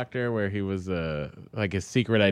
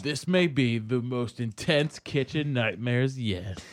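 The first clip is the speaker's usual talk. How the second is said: slow, dramatic trailer read; hammed-up reality show teaser; mock promo narrator; much slower than usual. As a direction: intone it like a TV promo.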